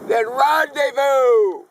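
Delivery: high, loud, drawn-out whoop of a line; exultant cry. Acted. Poet longing for spring rendezvous, exultant cry. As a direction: belt it out slowly as the big payoff. Poem's climactic longed-for gathering.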